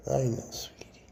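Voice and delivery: soft comfort murmured to a pet snake; whispered soothing. Real, not acted. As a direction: hush the animal gently.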